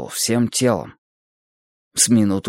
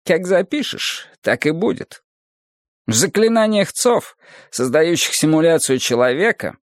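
lightly voiced teacher, higher than the narration; Adonis expounding; 5 semitones higher than usual. Adonis explains the code of human simulation, raised lecturing voice. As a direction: expound it in a lifted, insistent lecturing voice.

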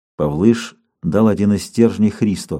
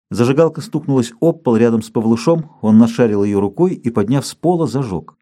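ordinary delivery, nothing marked

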